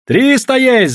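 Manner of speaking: high, loud yell; players' yell. A narrator's reading. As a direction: yell out the call with the crowd. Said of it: "заорали игроки".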